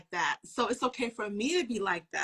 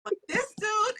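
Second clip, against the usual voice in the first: excited retelling; 9 semitones higher, 6 dB louder than usual. Retold or imitated. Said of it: "I was like".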